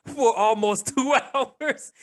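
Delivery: laughing high through the words; giggling while complaining. Real, not acted. Laughing about traffic commute.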